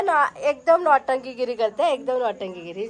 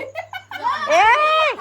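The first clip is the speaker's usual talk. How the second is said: laughing shout; 11 semitones higher than usual. Real, not acted. Mother laughing at toddler.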